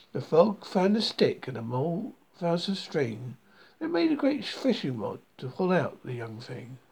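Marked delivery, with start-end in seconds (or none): none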